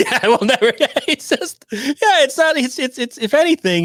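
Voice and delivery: giggling through the words; laughing. Real, not acted. Laughing reaction to the clip.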